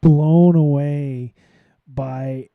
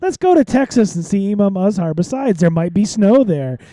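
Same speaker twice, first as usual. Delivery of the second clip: playful mock suggestion; 5 semitones higher, 4 dB louder than usual. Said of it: "you're like".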